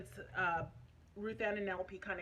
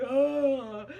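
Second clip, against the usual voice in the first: loud exasperated ugh; frustrated groan; 4 semitones higher, 12 dB louder than usual. Retold or imitated. Told the tubes news, a loud fed-up groan. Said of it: "so I'm just like".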